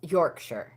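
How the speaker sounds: Yorkshire with a short, unstressed "sher" ending; -sher pronunciation. Shown as a demonstration. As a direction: say Yorkshire as "York-sher", falling at the end. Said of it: "you don't pronounce it Shire".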